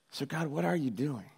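soft, earnest question to God; hushed, genuinely searching question; sincere questioning. Acted. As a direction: ask it quietly, without accusation. Asks again, no longer accusing.

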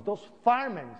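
ordinary talk

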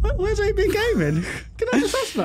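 high, hammed-up fake sob while laughing; mock crying. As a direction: whine it like a fake cry, half laughing. Complaining their channel was left out.

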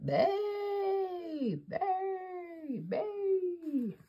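high drawn-out howling; bay like a coyote; coyote howls. Shown as a demonstration. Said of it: "Coyotes bay".

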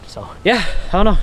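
winded and puffing; catching breath between words. Real, not acted. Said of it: "I ran out of breath just doing that".

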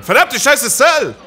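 loud, high raging yell at the boss; furious shout. Real, not acted. Gamer yelling at Cell mid-fight, furious shout. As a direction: scream it out in real frustration.

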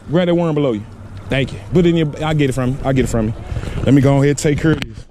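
ordinary talk

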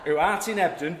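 loud Yorkshire prayer intonation; Yorkshire accent. Acted. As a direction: proclaim in broad Yorkshire. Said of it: "In a Yorkshire accent".

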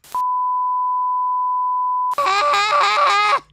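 high screaming cackle; shrieking laugh. Real, not acted.

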